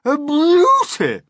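very high, loud, drawn-out cry. A narrator's reading. Aunt overjoyed by the peach, crying out. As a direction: let the admiration burst out slowly and loudly. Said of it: "Aunt Sponge cried out".

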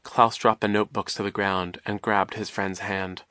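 plain reading voice

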